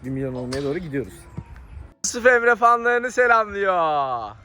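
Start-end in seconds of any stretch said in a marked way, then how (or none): 2.0-4.3 announcer voice. Pretend. Announcing the kid greeting his fans.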